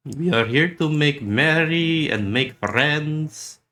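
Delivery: ordinary talk, nothing marked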